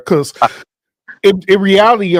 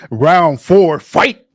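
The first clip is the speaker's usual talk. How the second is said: booming fighting game announcer; much slower than usual. Acted. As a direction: announce the round like an arcade fighter. Calls the match like a game.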